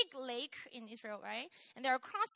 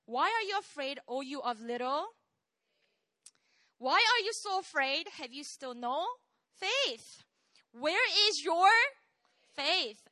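reproving Jesus; 3 semitones higher, 7 dB louder than usual. Acted. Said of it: "a common word in all three".

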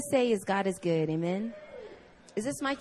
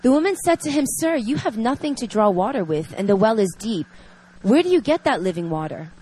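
reading scripture; 4 dB louder than usual. Acted. Reads scripture passage aloud.

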